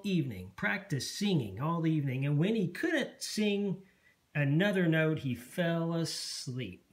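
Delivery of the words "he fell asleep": ordinary delivery, nothing marked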